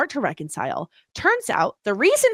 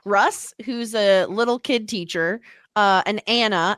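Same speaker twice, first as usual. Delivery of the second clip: ordinary delivery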